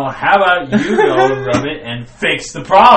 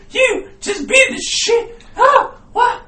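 stunned dog; 4 dB louder than usual. Retold or imitated. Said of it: "He was like".

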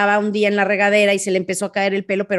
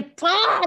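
"¡pah!" agudo y alargado; golpe de película imitado; 9 semitones higher than usual. Retold or imitated. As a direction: onomatopeya de golpe de caricatura. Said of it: "de película de Batman". (golpazo de película de Batman)

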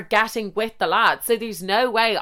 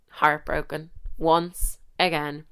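heartbroken and once again softer, lower and slow; mock-mournful; 5 semitones lower, 4 dB quieter, slower than usual. Storyteller mocking her own heartbreak, mournfully. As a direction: sigh it out like a sad ending. Dramatic self-pity.

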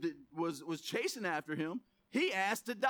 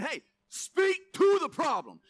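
raised, loud voice of God exhorting; emphatic urging; 7 semitones higher, 7 dB louder, slower than usual. Acted. Preacher voicing God to struggling believers, emphatic urging. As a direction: call it out high and forceful, driving each phrase.

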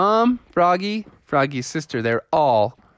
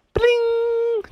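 high ringing "bling"; doorbell imitation. Kids knock on the first door, imitated doorbell. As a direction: ring it out like a doorbell.

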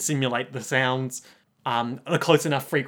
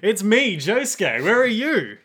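louder, higher eager phone call; excited call; 7 semitones higher, 5 dB louder than usual. Retold or imitated. Voicing Josuke on phone.

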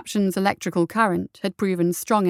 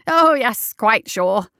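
emphatic; 3 semitones higher, 5 dB louder, slower than usual. A narrator's reading. Coroner reassures the doubting guardsman, emphatically. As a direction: insist with exaggerated certainty. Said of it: "The coroner nodded emphatically".

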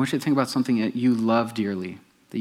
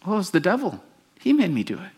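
higher mock excuse; lightly voiced Eve, higher; Eve blaming the devil; 8 semitones higher than usual. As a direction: innocent put-on voice passing blame. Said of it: "comes up to the woman".

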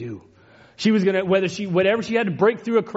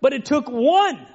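raised, higher pulpit voice building with feeling; fervent sermon delivery; impassioned preaching; 7 semitones higher than usual. Acted. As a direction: preach it out with fervour, climbing. Preaching rises again.

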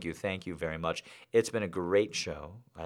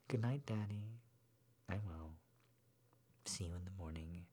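much softer, fading to a whisper; sleepy whispered goodnight; 19 dB quieter than usual. A narrator's reading. Girl whispering goodnight to daddy, sleepy. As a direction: drift off as you say it.

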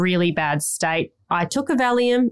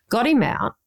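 ordinary delivery

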